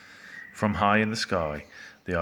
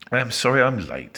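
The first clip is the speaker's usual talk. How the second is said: loud, higher call; breezy raised-voice entrance; 6 semitones higher, 8 dB louder than usual. A narrator's reading. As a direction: call out an apology across the shop. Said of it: "Slime called out".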